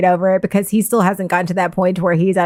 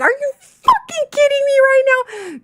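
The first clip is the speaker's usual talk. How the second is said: high incredulous outburst through a laugh; disbelieving, half laughing rage; retold anger. Wife reliving her angry disbelief at him, high and laughing. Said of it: "turned ... to just anger".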